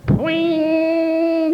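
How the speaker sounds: boing sound effect. Retold or imitated. Antenna going up, mock sound.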